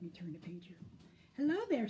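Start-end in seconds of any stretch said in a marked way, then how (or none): 1.4-1.9 light, higher, friendly greeting; lightly voiced bear, pitched up. A narrator's reading. Bear greets Skunk, lightly voiced, higher. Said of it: "said Bear".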